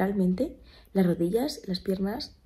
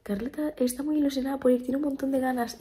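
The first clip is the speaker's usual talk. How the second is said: mock-keen email voice, pitched up; imitating agency rep's email; 6 semitones higher than usual. Agency rep writing that Carlota is keen, imitated mock enthusiasm. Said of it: "Y escribió el mail".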